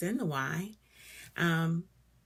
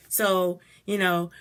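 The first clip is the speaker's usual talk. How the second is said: ordinary talk, nothing marked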